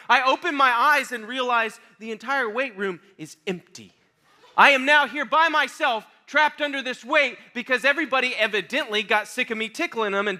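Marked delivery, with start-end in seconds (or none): none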